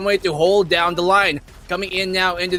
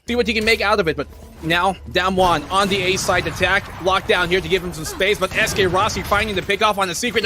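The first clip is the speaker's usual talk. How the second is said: normal talking voice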